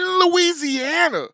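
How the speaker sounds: high, loud, slow stretch; excited hype. Acted. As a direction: belt it like a hype man. Hype intro of guest.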